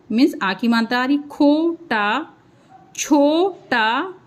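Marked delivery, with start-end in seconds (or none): 1.2-2.3 slow sounding out. Shown as a demonstration. Teacher sounding out खोटा slowly for pupils. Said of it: "देखिए टा पूरा आ रहा है". 3.0-4.1 slow sounding out. Shown as a demonstration. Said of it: "देखिए टा पूरा आ रहा है".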